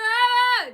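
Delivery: high, loud mimicked shriek; mock scream. Vlogger imitating store hecklers breaking into a scream. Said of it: "They start screaming".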